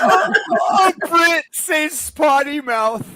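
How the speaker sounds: high, loud laughing cry; cracking up mid-sentence. Real, not acted. Laughing at a Brit's word for swearing, laughing hard.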